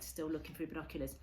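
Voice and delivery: plain reading voice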